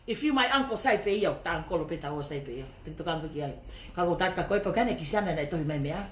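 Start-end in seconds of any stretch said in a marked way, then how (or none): none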